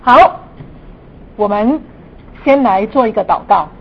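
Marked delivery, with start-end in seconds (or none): none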